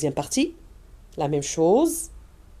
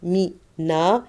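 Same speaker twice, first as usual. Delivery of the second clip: syllable demo; 4 dB louder, much slower than usual. Shown as a demonstration. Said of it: "Deux syllabes".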